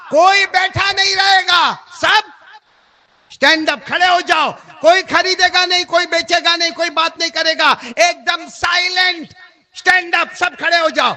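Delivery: loud, high herald's command to the market; commanding herald calling out; royal decree shout. Acted. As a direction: bellow the king's order so everyone stands. Royal order for crowd to stand.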